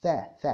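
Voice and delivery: ث sounded alone, twice, as unvoiced TH; isolated TH sound. Shown as a demonstration. Teacher isolating the sound for student, short ث bursts. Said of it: "ما عندهمش أصلًا في اللانجوج بتاعتهم الث".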